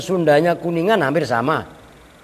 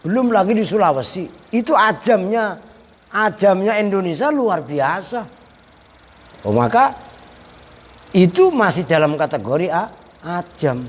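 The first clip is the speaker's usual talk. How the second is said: normal talking voice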